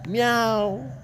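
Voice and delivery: high, drawn-out imitated meow; cat meow. Cat answering the man's scolding, high imitated meow. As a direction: answer back as an insistent cat. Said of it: "The cat with the big ass come here and say".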